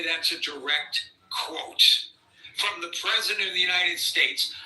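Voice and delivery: usual speaking voice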